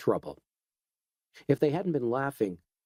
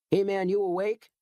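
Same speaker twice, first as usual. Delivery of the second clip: put-on higher, eager young guy; young man voice; 8 semitones higher than usual. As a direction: nudge him awake, upbeat. Newcomer waking Edgar.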